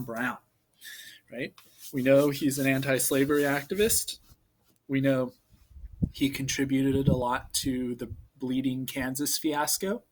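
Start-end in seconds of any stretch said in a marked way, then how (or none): none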